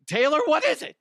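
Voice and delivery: high, loud, breathless ask; eager demand. Acted. Host begs pretend [name] for her announcement, high excited ask. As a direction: beg for the big reveal.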